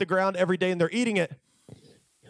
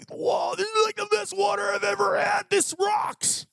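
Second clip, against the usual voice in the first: thrilled crowd; 14 semitones higher than usual. Retold or imitated. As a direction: gush over the best water ever. People thrilled by rock water.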